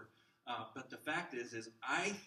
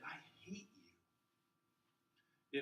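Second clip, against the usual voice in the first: weak hate; 3 semitones higher, 6 dB quieter than usual. Shown as a demonstration. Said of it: "might not have the same power".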